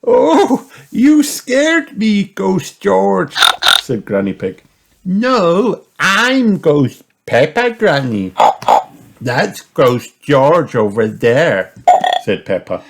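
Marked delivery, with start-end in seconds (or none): 0.0-3.3 high, loud, slow mock fright; startled granny. A narrator's reading. Granny scared by a little ghost, high startled voice. 5.1-11.7 put-on loud, raised little-girl voice; giggly Peppa. A narrator's reading. Peppa corrects Granny about the ghosts, loud child voice. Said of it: "pointing and giggling".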